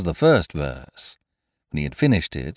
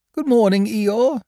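much higher, bright bear voice; cheerful Pooh; 13 semitones higher than usual. A narrator's reading. Pooh Bear greets his gloomy friend, high and cheerful. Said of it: "said Pooh".

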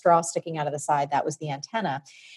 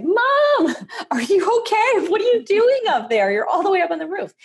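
put-on much higher, louder calling-out child voice; yelling up to mom; 5 dB louder than usual. Kid calling up to mom on the roof, high alarmed yell. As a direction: shout up to the roof, high and worried. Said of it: "And I yelled up".

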